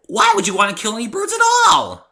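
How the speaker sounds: very high and loud, appalled; horrified Pinky. A narrator's reading. Pinky is appalled that the narrator would kill birds, high shriek. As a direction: squeal in disbelief.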